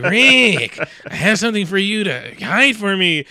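put-on high sly voice; shifty schemer. Beady-eyed guy asking Rick to hide something, in a shifty high voice. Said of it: "he talked like this".

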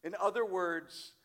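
ordinary delivery

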